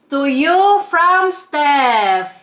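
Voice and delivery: very slow, stretched-out shop name; drawn-out plug. Real, not acted. Vlogger urging viewers to order, shop name drawn out. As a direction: draw out every word for the viewers.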